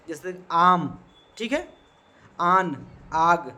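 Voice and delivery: आम, आन, आग with the first vowel stretched very long and slow; long drawn vowel. Shown as a demonstration. Teacher stretching the madd vowel for students, slow and drawn out. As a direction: drag out the aa in आम, आन, आग. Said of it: "खींचते".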